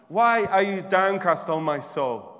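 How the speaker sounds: usual speaking voice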